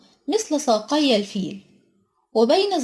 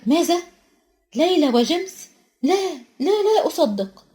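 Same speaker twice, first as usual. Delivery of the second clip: higher, shocked disbelief; a narrator's raised, stunned outcry; shocked cry; 5 semitones higher than usual. A narrator's reading. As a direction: gasp it out as unbelievable news. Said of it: "صرخت الأستاذة ماكجونجل".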